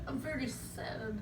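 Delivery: a sad little puppet, murmured softly. Acted. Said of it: "You're, you're sad?".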